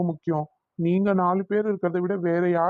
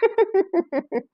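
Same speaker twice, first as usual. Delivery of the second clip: high, bursting chuckle; high-pitched belly laugh; amused laugh; 12 semitones higher than usual. Acted. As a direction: burst out laughing at a child's drama. Uncle amused by lovesick child.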